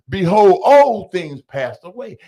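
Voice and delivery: slow, loud Bible proclamation; preaching. Acted. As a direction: proclaim it from the pulpit. Preaching scripture quote.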